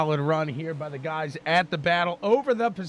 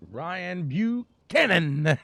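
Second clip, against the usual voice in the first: "Buchanan" drawn out slowly with exaggerated stress; playful wrong emphasis; much slower than usual. Real, not acted. Announcer teasing with a driver's name, playfully overstressed "Buchanan". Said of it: "putting the wrong emphasis".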